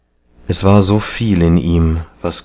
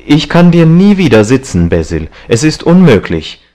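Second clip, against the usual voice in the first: high, louder outcry of refusal; exclaiming; 8 semitones higher, 5 dB louder than usual. A narrator's reading. Young man refusing to sit for the painter, exclaiming. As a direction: cry it out as impossible. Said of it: "rief er aus und trat zurück".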